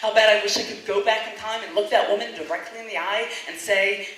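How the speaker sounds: normal talking voice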